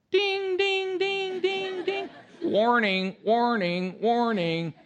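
high, loud mock alarm ding and warning; alarm bells. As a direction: ring out like an inner alarm going off. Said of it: "something rose up in me and went".

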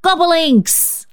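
put-on very high, gleeful child's cry. A narrator's reading. Cecil echoing the new game, high gleeful cry. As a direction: shout the magic word. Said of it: "cried Cecil".

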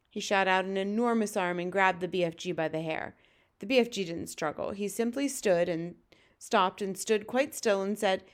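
ordinary delivery

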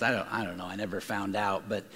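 ordinary delivery

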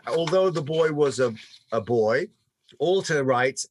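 ordinary talk

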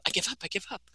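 a friend throwing in the towel, mock tired; imitating a weary surrender. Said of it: "where she goes".

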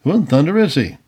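ordinary delivery

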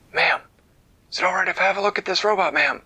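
high, fast little soldier voice; high gingerbread man. Acted. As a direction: chirp it quickly like a tiny soldier.

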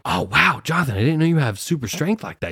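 put-on high, hushed wow for a kid; mock amazement. Teacher praising boy's super strength, high feigned amazement. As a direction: act impressed for a little one.

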